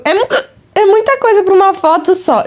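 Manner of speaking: talking between hiccups; hiccuping while eating. Real, not acted. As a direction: hiccup through the words. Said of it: "eu tô com soluço".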